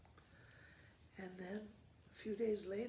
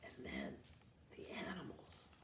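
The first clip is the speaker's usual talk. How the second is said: whisper. Real, not acted. Teacher whispers to the class.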